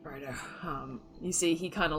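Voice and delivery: ordinary delivery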